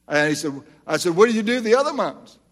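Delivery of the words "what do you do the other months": quick, higher, pressing question; pointed question. Retold or imitated. Preacher retelling how he challenged the pastor, quick. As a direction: press him, half teasing.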